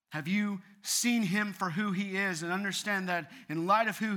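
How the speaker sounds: forceful, weighty sermon delivery; preaching at full force. Real, not acted. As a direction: press the congregation with slow, emphatic questions.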